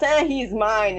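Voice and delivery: ordinary talk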